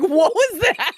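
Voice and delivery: high giggly voice, laughing through the question; giggling friend. Retold or imitated. Friend reading a garbled text, giggling through it. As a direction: imitate a friend giggling in disbelief.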